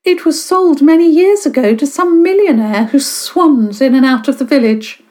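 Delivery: high, sniffy and disapproving; disapproving sniff. Acted. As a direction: look down on the rich newcomer. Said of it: "she sniffed, obviously disapproving".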